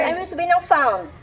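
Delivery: normal talking voice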